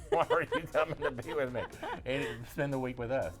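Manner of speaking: laughing through a teasing question. Real, not acted.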